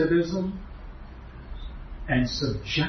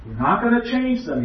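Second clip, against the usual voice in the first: "gonna" pressed higher in the quote; quoting John XXIII; 7 semitones higher than usual. Retold or imitated. Preacher quotes the pope at Vatican II, emphatic. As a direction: repeat the pope's promise with weight on "gonna". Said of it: "John the twenty-third said".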